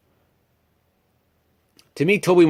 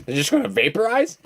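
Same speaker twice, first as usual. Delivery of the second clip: surprised disbelief; 7 semitones higher than usual. Retold or imitated. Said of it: "I was like".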